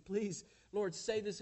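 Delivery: loud, high desperate plea; frantic begging. Retold or imitated. Disciples beg Jesus in the storm, loud frantic plea. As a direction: cry out to be saved from drowning.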